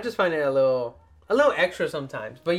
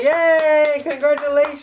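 higher, louder cheering; excited cheer; 11 semitones higher, 8 dB louder, slower than usual. Real, not acted. Son cheering his mom's Costco job, excited. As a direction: celebrate big.

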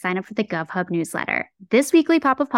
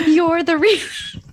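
laughing quote; 7 semitones higher, 3 dB louder, slower than usual. Retold or imitated. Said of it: "he was like".

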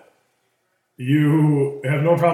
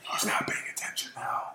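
whispered aside; 11 dB quieter than usual. Real, not acted. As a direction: whisper it under your breath. Sarcastic aside while being scolded.

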